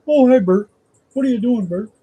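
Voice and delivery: chirpy muppet calling to his buddy; Ernie impression. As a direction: greets Bert in a high, cheery muppet voice. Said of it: "Ernie's voice in your head".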